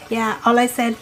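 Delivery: ordinary talk, nothing marked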